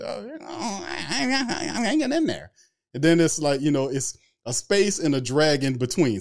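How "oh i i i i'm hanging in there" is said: very high, stuttering, near-crying stall; Mickey Loomis impression. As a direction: stammer it out way up high. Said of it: "a lesson on how to do the Mickey".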